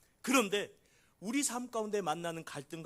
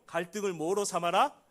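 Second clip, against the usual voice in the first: usual speaking voice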